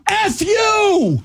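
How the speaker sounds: high, shouted "F you" as the furious driver; mock yell. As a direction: scream it back like an angry driver. Driver yells at him.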